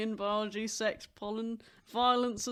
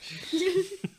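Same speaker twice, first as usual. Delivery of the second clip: loud laugh, higher than her talk; 11 semitones higher, 8 dB louder than usual. Real, not acted. Joking with friends about waking up, loud high laugh. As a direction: crack up at her own joke.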